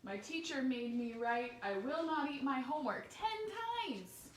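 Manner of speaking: put-on lively read-aloud to children; picture book read to a class; story reading. A narrator's reading. Reading Diary of a Worm aloud.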